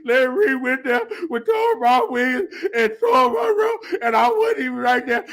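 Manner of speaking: high hammed-up crying wail; mock wail. Mocking a left-out man, hammed-up crying wail. Mocks crying over not invited.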